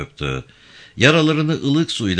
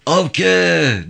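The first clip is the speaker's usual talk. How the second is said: high, loud drawn-out call of a name; shouted call; 9 semitones higher, 4 dB louder than usual. A narrator's reading. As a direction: call out far and urgently for a missing person. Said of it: "diye bağırarak".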